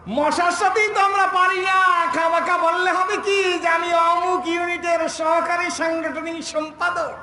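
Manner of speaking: high, slow, drawn-out retort; mock declaiming. Preacher retorting to the banner about party officials, mock declaiming. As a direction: stretch it out like a pompous title.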